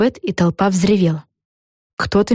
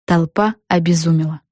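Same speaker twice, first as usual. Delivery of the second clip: usual speaking voice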